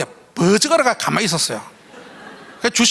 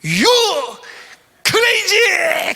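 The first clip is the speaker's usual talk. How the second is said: high, loud insult yelled; umpire shouting; 10 semitones higher, 3 dB louder, much slower than usual. Retold or imitated. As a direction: yell it right in his face. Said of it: "그런 거야".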